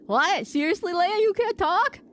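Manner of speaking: high, loud incredulous question; shocked disbelief. Real, not acted. Streamer learns Leia cannot talk, shocked high voice. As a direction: react with stunned disbelief.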